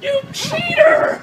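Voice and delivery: high, slow, outraged shout; loud outraged yell. Real, not acted. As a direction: yell the accusation, half laughing. Partner tricked him.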